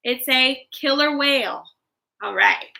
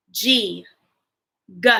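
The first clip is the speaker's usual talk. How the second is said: ordinary delivery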